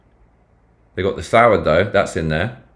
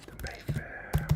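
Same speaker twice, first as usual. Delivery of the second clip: whisper; 18 dB quieter, slower than usual. Real, not acted. Whispered restaurant name.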